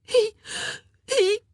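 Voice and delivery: high, loud sobbing gasps; broken, tearful stammer, pitched very high; gasping sobs. A narrator's reading. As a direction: gasp it out through tears. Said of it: "she gasped".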